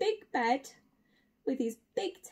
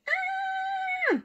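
mock scream. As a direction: squeal as the fleeing bear. Acts the bear fleeing in fright.

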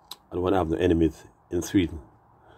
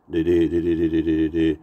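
stammering; 4 dB louder than usual. Retold or imitated. Acting out stammering for the word.

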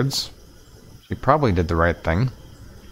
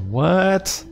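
surprised; 9 semitones higher than usual. Acted. Stunned at sudden dismissal.

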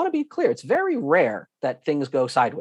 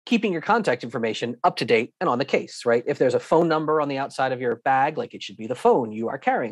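usual speaking voice

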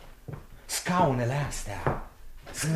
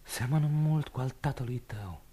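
usual speaking voice

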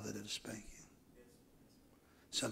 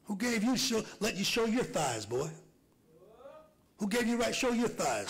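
stern scolding; 8 semitones higher than usual. Retold or imitated. Scolding voiced father.

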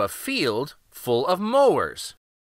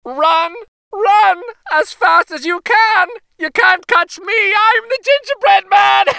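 high, loud, gleeful taunt; sing-song jeer at his chasers; gingerbread boy taunting shout; 9 dB louder than usual. A narrator's reading. Runaway cookie shouting back at old couple, high gleeful taunt. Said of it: "shouted back to them".

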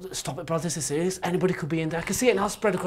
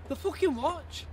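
horrified; 10 semitones higher, 4 dB quieter, slower than usual. Acted. Horrified at the watch.

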